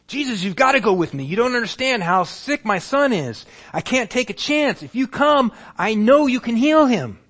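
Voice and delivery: high, loud urgent begging; desperate pleading. Acted. Father pleading with Jesus to come heal his son. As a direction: press Jesus, won't take no.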